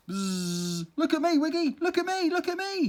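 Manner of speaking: high, loud, bouncy boasting; show-off cry. Acted. Bee showing off to the digging earwig, high and excited. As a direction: crow it out like a show-off.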